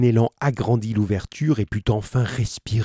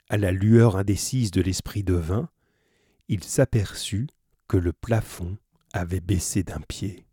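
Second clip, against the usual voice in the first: usual speaking voice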